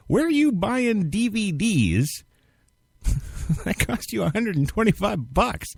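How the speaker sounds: incredulous. Real, not acted. Host incredulous at DVD cost.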